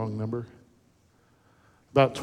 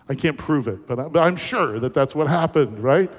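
ordinary talk

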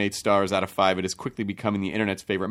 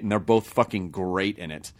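ordinary talk

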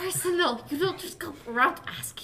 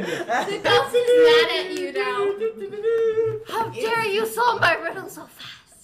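mock angry; 7 semitones higher, 8 dB louder than usual.

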